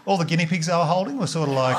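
usual speaking voice

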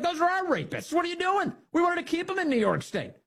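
high whiny mock outrage; mock outraged Democrats. New York Democrats protest ICE arrests, high mock whine. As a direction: squeal it like indignant politicians. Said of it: "Those are like".

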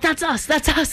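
imitating excited dad. Daughter quoting dad watching his recorded concert, high and excited. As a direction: put on a thrilled dad, pitched well up. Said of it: "He's like".

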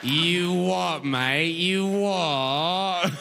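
long, stretched mock-Cockney vowels; mock British accent. Delighted listener mimicking instead of helping, hammed-up British accent. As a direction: parrot British slang, stretching every vowel. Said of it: "They're just making sounds you like".

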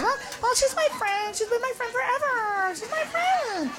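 high, very soft pleading; small, whiny little voice; meek girlfriend. Acted. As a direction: timidly defend your friend to a boyfriend. Girlfriend meekly defends her friend.

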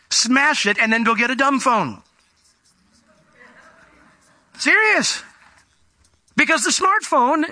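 high, ringing preacher's voice, pressing hard; fervent preaching. Acted. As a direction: drive the call home from the pulpit. Preaching at full force.

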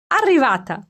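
high, loud triumphant cry; girl bowing to an invisible audience, triumphant; exclaimed. A narrator's reading. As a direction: announce it to an invisible crowd. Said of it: "esclamò".

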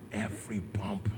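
whispered, breathy aside; hushed. Retold or imitated. Father telling of the drive to hospital, whispered. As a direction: drop to a whisper on the bump. Son feeling each road bump.

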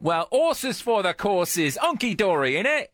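slow British idioms, lightly put on; British accent. Shown as a demonstration. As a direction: reel off British slang. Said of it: "British terminology".

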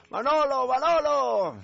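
very high, ringing name call; high-pitched holler. Acted. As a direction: yell for someone far down the line. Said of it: "empiezan a gritar".

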